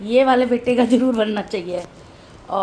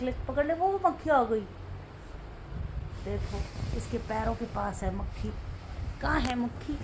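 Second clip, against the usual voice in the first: softer voice so as not to disturb the dog; hushed commentary; 7 dB quieter than usual. Real, not acted. Mother quietly narrating her dog stalking a fly. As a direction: narrate quietly like a nature watcher.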